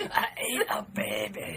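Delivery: slow, put-on Fat Bastard voice; Fat Bastard impression. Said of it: "That's not, that's not it".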